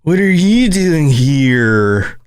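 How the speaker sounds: very slow laid-back surfer drawl; a stoned California surfer; California accent. Retold or imitated. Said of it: "think about the Californians".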